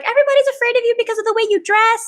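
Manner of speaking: put-on high, fast anime girl voice; high cute anime girl. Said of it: "that's my anime voice".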